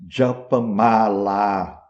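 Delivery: "Japamala" slowly, dj sound, full open final a; Sanskrit pronunciation. Shown as a demonstration. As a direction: say "Japamala" carefully as Sanskrit, dja-pa-ma-laa. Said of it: "em sânscrito".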